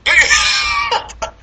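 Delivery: loud, very high burst of laughter; shrieking laugh. Real, not acted. Co-host bursting out over unnamed Transformers, shrieking laugh. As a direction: explode into a squealing laugh.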